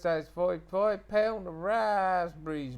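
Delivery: Suffolk accent. Shown as a demonstration. Speaker demos a Suffolk market seller, broad Suffolk accent. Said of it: "Suffolk is kinda more like this".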